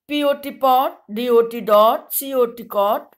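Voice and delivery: ordinary talk, nothing marked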